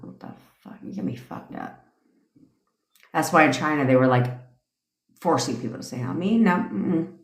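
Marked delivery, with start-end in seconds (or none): 0.0-1.8 whispered aside. Real, not acted. Muttered aside, unvoiced.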